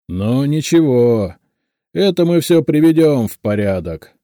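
clanging, indignant dressing-down; angry deafening boss. A narrator's reading. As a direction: berate a subordinate at full volume. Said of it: "Лысый Квадратный неожиданно рассердился".

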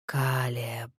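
long, low, soft drawn-out name; drawn-out undead voice. Acted. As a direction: stretch the name like a dead thing. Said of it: "протянула мёртвая голова".

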